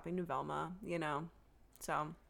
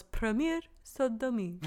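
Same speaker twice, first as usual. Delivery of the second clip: mock French accent; 3 semitones higher than usual. Shown as a demonstration. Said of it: "butchering your beautiful language".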